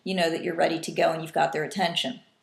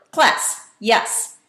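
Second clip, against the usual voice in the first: short, loud "class", snappy "yes"; quick and short; 3 semitones higher, 6 dB louder than usual. Shown as a demonstration. Teacher showing a quick class call and answer, short and quick. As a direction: fire it off as one very short word. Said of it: "sounds like a very short word".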